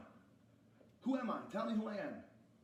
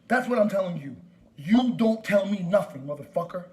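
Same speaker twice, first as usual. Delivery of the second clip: loud, emphatic angry shout; furious insistence; 6 dB louder than usual. Real, not acted. Caller refuses store worker's orders, loud and furious. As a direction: drag the words out hard and loud.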